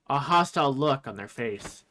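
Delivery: ordinary talk, nothing marked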